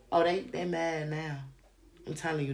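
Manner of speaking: ordinary talk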